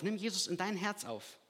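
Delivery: ordinary talk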